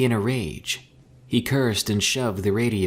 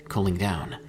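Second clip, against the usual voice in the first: plain reading voice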